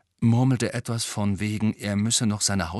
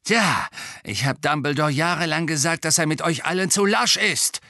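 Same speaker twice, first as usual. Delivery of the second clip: loud, high, gloating caretaker; nasty, raised character voice; malicious glee; 8 semitones higher, 5 dB louder than usual. A narrator's reading. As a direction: crow spitefully about being proved right. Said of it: "gluckste boshaft".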